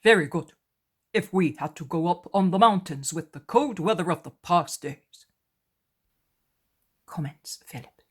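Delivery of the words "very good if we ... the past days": much louder, pleased; voiced apostle. Philip telling the others the timing is good, louder. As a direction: Philip approving, said out loud.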